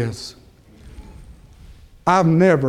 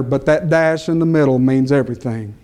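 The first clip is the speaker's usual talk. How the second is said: ordinary delivery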